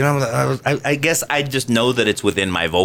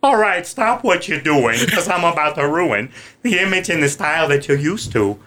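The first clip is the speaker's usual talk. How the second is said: [name] voice; 4 semitones higher than usual. Shown as a demonstration. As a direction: lay back into a goofy rap persona. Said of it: "I do [name]".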